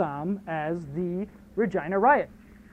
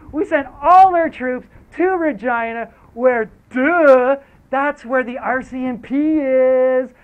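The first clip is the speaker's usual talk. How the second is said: higher, louder, slow mocking yell; gloating villain shout; 5 semitones higher, 7 dB louder, slower than usual. The Prime Minister taunting the strikers, slow loud gloating.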